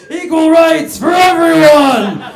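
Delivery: slow, loud, laughing bellow; a giant bellowing slogans in a hammed-up roar. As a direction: bellow it like a giant stomping through the woods. Stomping woods feminist giant roar.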